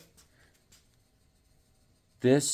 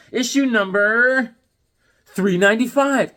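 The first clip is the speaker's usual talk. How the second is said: announcer voice; 6 semitones higher, 5 dB louder than usual. Acted. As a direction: boom it out like a game show host. Announcing comic issue number.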